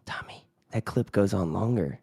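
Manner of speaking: soft, breathy whisper. Real, not acted. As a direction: whisper it off-mic. Quiet aside to the producer.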